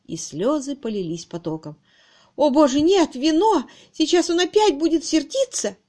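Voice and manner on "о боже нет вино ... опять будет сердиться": high, panicky outcry; horrified. A narrator's reading. Miss Twitterton realising about the wine, horrified. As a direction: cry out in fresh dread. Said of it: "Новый ужас сковал её сердце".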